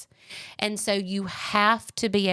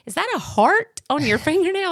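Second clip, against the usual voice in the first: son's amused question; 9 semitones higher, 5 dB louder than usual. Retold or imitated. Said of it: "He said".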